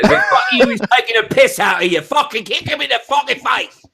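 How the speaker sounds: high-pitched mock East London tough guy. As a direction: rant like a hammed-up East London hard man. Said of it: "You've gone East London".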